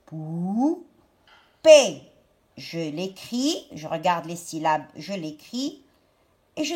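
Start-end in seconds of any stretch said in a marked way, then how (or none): 0.1-2.0 "poupée" with a long "pou", then a louder "pée"; syllable-by-syllable "poupée"; split into syllables. Shown as a demonstration. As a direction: hold "pou", pause, land on "pée". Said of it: "ça va me faire le mot".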